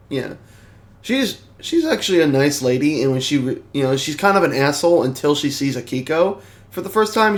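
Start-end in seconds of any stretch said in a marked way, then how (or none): none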